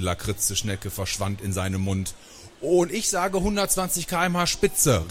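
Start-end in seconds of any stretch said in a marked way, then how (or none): 2.6-5.1 high-pitched boast called out; triumphant card-game call; excited call. A narrator's reading. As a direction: call it out high to the other players. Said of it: "rief ich".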